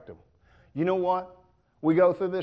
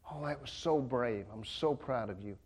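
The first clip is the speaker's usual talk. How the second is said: softer, lower, proud parent; tender praise; 4 semitones lower, 6 dB quieter than usual. Pretend. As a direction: hold the child close and praise. Parent praising child who jumped.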